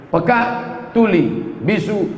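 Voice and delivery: ordinary talk, nothing marked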